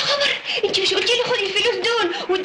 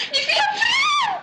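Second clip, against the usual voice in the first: much higher, crying shout; high, tearful child's panic; frightened cry. Acted. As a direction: squeal it in panic.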